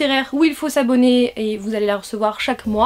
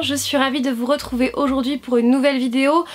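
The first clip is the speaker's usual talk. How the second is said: ordinary talk, nothing marked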